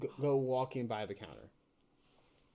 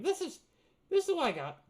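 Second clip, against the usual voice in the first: high goblin voice; 8 dB louder than usual. Acted. Goblin shopkeeper presenting goods.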